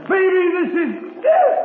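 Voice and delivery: slow anguished wail about death; delirious cry. Acted. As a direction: let the fear break out on the last word. Delirious man fears death.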